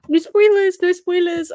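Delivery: high, loud panicked plea; squealing no spoilers; pleading no spoilers. Real, not acted. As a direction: cover your ears and beg them to stop. Begging chat not to spoil.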